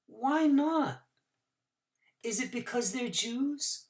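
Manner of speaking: put-on high child's voice, softening; boy asking Doctor Korchak why not, high child's voice; questioning boy. A narrator's reading. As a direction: ask it like a troubled little boy.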